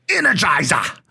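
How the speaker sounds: Australian commercial pitchman. Retold or imitated. Friend imitates the Australian battery ad man, punchy Australian accent. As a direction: belt out an Australian battery slogan. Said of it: "he'd always be like".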